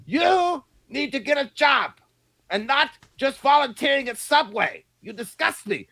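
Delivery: hammed-up nagging yell; mock parental shout. Mock parent yelling at kid to get a job.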